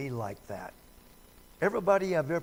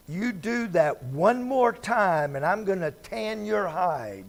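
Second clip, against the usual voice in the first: loud, higher mock threat to spank; threatening parent; 4 semitones higher, 6 dB louder than usual. Parent threatening a kid with a spanking, loud and high. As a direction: bark the threat at a naughty kid.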